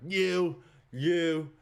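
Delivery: loud, high single-word picks; guard calling out. Acted. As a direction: bark out each pick like a guard. Guard picking out more prisoners.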